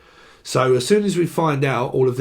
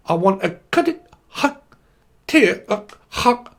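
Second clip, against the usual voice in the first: choppy, garbled mock order; breaking-up customer; 5 semitones higher than usual. Pretend customer ordering at McDonald's, choppy and garbled. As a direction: cut the words into stray bursts. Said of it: "going up to a McDonald's".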